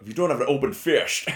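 Scottish Connery voice; Sean Connery impression. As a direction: do the Connery accent. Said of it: "Sean Connery talking to Barbara Walters".

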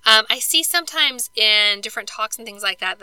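ordinary talk, nothing marked

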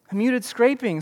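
usual speaking voice

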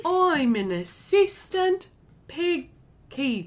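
slow high mock Welsh accent; slow put-on Welsh lilt. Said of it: "that's what a Welsh accent is".

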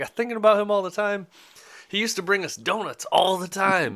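high-pitched, eager gushing; so excited. Retold or imitated. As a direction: imitate someone thrilled about an old friend. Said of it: "he was so excited".

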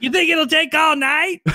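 mock high, loud, drawn-out outburst; high-pitched comic holler; Samuel L. Jackson. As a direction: act out an over-eager actor jumping at an offer. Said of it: "It'd be like".

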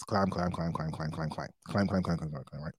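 climbing chant dying off to a murmur; fading repeated climb. Retold or imitated. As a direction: let each climb fade into the distance. Mimicking repetitive climbing.

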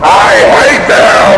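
high furious yell; angry shout. Acted. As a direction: shout it in a rage. Demon's outburst.

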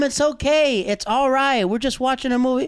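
high mock mom voice, pleading; girlfriend's mom hushing them, high pitched; imitating her mom.

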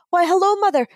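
a hint of a well-mannered leprechaun; posh leprechaun. Posh leprechaun greeting Mother Superior, lightly put-on polite voice. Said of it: "the posh looking leprechaun looks at her and he goes".

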